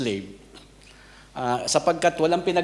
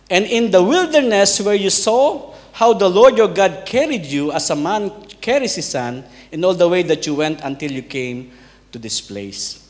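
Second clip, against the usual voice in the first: scripture reading; 3 semitones higher, 7 dB louder than usual. Acted. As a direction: read the verse aloud to the congregation. Reading Bible verse aloud. (taimtim na pagbasa ng Banal na Kasulatan)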